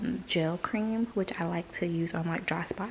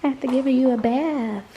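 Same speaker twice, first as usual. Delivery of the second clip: higher, louder pet-talk voice; sing-song voice for the dog; 6 semitones higher, 7 dB louder than usual. Real, not acted. Talking to her dog.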